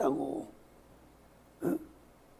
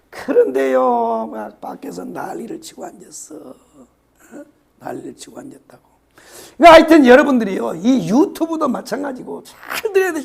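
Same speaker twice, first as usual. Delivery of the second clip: ordinary talk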